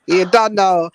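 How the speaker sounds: ordinary talk, nothing marked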